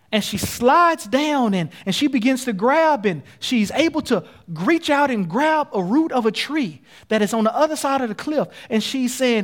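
urgent, punched-out preacher storytelling; impassioned preaching. Acted. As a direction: preach the danger with rising intensity. Preacher dramatizing the fall.

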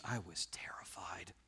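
slow, hushed whisper; terrified whisper. Acted. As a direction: let the voice drop away into a fearful whisper. Psalmist's fear acted in reading.

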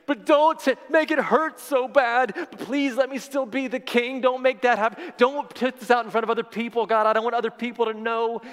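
high, pleading voice begging God; pleading David. Acted. David pleading against punishment, acted.

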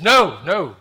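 commanding, acted objection; firm rebuke. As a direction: cut him off forcefully. Jesus halts Peter's sword attack.

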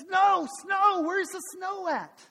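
put-on high, shouted kid tantrum; hammed-up angry child; stomping tantrum. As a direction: stamp and yell the demand, dragging the end. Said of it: "stomping around like every good kid does".

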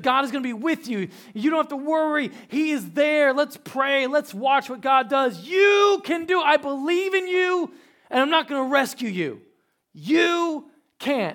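high, loud, imitated motherly exhortation; rousing pep talk. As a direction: build to a shouted vote of confidence. Acts out wife's rousing prayer talk.

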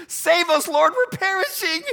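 high, loud desperate cry for help; yelling into the wind. Acted. Disciples crying out to Jesus in the storm, loud and desperate. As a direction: shout it over the storm as if sinking. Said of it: "they're yelling into the wind".

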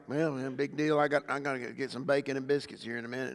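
quick, low mumble of indifference; mock a bored listener, soft and hurried; unimpressed congregation. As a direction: shrug it off under the breath. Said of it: "Y'all looking at me like".

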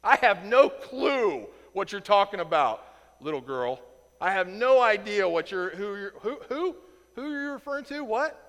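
loud, hammed-up playing dumb, rising into a high what; overdone denial. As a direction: sell the fake confusion over the top. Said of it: "He's really trying to sell it".

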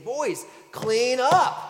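slow high call of a fed-up little daughter; little girl calling out. Retold or imitated. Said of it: "I could hear Annie saying".

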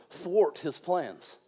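"thwart" slowly, leaning on it; savoring the word thwart. Shown as a demonstration. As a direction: relish "thwart" drawn out. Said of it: "I like this word".

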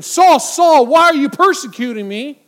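shouted Saul Saul, full force; booming shout. Shown as a demonstration. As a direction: boom it out like a voice from heaven. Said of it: "It could have been".

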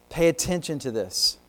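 ordinary talk, nothing marked

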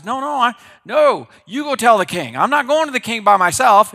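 high, loud, desperate protest; panicked refusal. Retold or imitated. Obadiah refusing to face the king alone, loud panicked protest. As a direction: refuse in a frightened rush.

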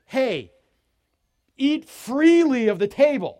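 loud, high, drawn-out call to the table; emphatic invitation. Acted. Host invites everyone to eat freely, calling out loudly. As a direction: throw the feast open at full voice.

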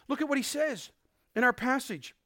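normal talking voice

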